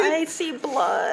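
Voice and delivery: high, slow, hammed-up wail; drawn-out fake suffering; mock agony. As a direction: play along as if badly hurt. Joins the joke about hurting ears.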